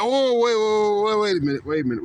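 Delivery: high loud cry of wait, then calming down; gatekeeper stopping someone. Retold or imitated. Peter at heaven's gate stopping a sinner, loud high halt fading down. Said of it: "they facing Peter".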